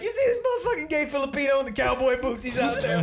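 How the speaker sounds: high, loud incredulous stand-up bit; comedian on stage. Retold or imitated. Comedian to the theater about the cowboy-booted greeter, high loud stage voice. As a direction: project it high like a stand-up imitation. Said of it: "And then he's like".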